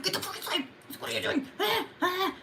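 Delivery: high, strained order without volume; mock scolding. Owner chasing cat back inside, high mock scolding. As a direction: act out frantic shooing, held back. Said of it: "I'm so fucking mad".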